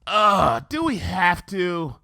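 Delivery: slow groaning complaint; Rainbow, grudging; reluctant whine. Acted. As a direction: drag out the protest. Rainbow whining.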